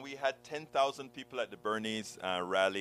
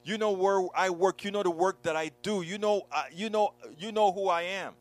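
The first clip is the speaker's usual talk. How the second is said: upset friend; 6 semitones higher, 4 dB louder than usual. Retold or imitated. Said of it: "she comes to me crying".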